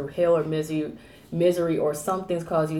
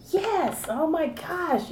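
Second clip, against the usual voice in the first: high-pitched, drawn-out mock exclamation; acted-out thrilled recognition, much higher; imagined excited listener; 11 semitones higher, much slower than usual. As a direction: squeal it as a listener who totally relates. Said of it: "somebody out there is going".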